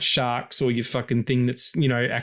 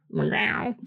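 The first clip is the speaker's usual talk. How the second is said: imitated meow; 9 semitones higher than usual. Real, not acted. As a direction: meow back at the cat. Imitating cat back on podcast.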